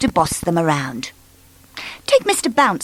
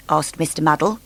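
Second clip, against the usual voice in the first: plain reading voice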